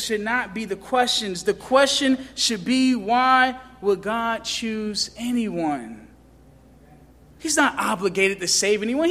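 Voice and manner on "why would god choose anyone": normal talking voice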